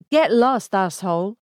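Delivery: returning fire, loud at the start; indignant shout. A narrator's reading. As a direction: shout back at a rude driver. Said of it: "she shouted".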